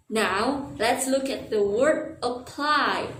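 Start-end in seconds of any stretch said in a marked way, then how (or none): none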